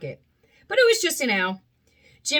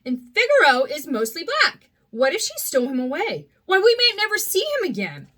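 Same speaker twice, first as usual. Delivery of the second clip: worried puppet; 7 semitones higher, 4 dB louder than usual. Acted. Pinocchio fearing for Figaro.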